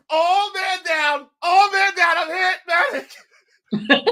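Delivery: high, drawn-out wail for help; hammed-up wounded soldier calling out; mock battlefield cry. Ketchup victim plays a casualty calling a medic, mock wail.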